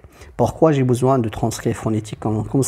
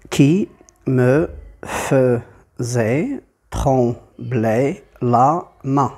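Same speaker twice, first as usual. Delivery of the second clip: very slow, detached syllables; teacher's drawn-out scansion with gaps; much slower than usual. Shown as a demonstration. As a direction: chop the verse into single syllables for counting. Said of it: "Et j'obtiens huit, huit syllabes".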